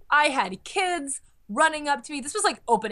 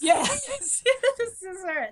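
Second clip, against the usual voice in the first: high, squeaky laughing reply; laughing delight; 9 semitones higher, slower than usual. Retold or imitated. Cosplayer answering the young fan, laughing with delight. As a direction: break up laughing as you answer.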